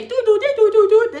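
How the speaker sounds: high sung doo doo doo; imitating a disconnected-number tone; phone disconnect tones. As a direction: mimic the three-tone phone error. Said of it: "that number say".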